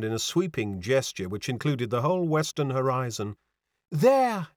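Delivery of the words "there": high-pitched exclamation; French girl's high exclamation; exclaimed cry. A narrator's reading. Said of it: "she cried".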